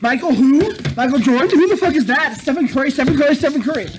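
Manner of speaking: overexcited mock fan gushing loudly over Stephen Curry; mock swooning fan.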